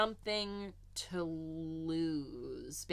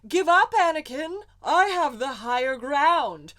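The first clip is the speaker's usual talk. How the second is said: dramatic movie quote; 8 semitones higher, 14 dB louder than usual. Retold or imitated. Quoting Obi-Wan in fight scene.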